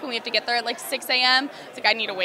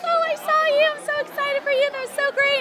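very high, squealy fan voice; high-pitched mock fangirl gush. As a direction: imitate excited fans gushing, pitched way up. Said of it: "people on Twitter being like".

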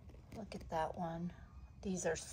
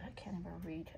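usual speaking voice

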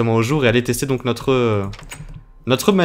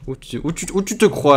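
high, playful telling-off; indignant but not serious; mock scolding; 3 semitones higher than usual. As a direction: scold a little creature in mock outrage.

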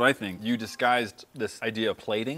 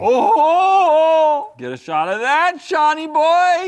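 loud, high whoop stretching into a yell; excited shout; 12 dB louder, much slower than usual. Real, not acted. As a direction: holler it out, drawing out the last words. Excitedly calling out over the plated steak.

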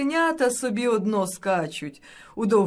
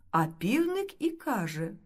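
usual speaking voice

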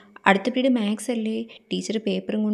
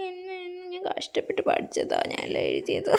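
crying; 9 semitones higher, 7 dB quieter than usual. Acted. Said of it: "why are you crying?".

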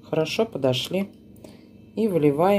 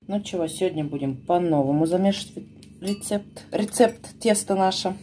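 ordinary delivery

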